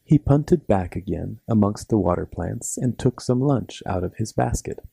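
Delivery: plain reading voice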